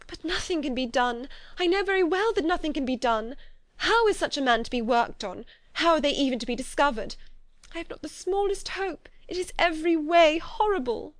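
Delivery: rising into despair. A narrator's reading. Elizabeth despairing.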